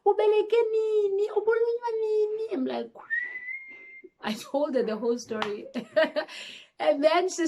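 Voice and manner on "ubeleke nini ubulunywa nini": much higher, tearful astonishment; a mother stunned by the news; shocked mom. Retold or imitated. Mom hearing of the birth, high and tearful. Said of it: "She's like".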